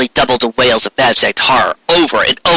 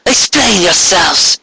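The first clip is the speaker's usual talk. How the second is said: commanding; 7 semitones higher, slower than usual. Acted. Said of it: "she commanded".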